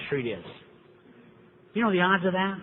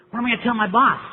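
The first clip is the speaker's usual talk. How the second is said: anxious, raised and louder panicky question; worried sponsee; 5 semitones higher, 5 dB louder, faster than usual. Retold or imitated. Newcomer asks sponsor what to tell boss, anxious. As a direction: play the scared newcomer fretting to his sponsor.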